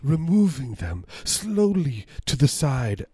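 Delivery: a hammed-up German villain savoring each word; mock German accent. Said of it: "That's my German Nazi".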